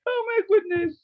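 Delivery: high exasperated oh my goodness; exasperated surprise. Real, not acted. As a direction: throw up your hands at the mistake. Teacher exasperated at student answer.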